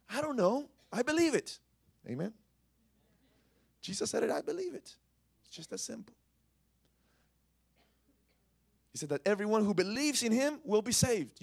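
high, loud testimony; preaching at full force. Acted. Pastor crying out belief to the congregation. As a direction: fire the faith line at the room.

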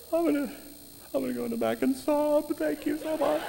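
high, soft, teary voice with a laugh; a grateful waitress fighting back tears; Kate, choked up. Retold or imitated. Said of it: "She goes".

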